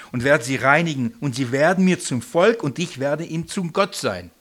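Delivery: a loud, solemn Bible reading; scripture reading. Acted. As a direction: proclaim the verse to the room. Reads scripture aloud.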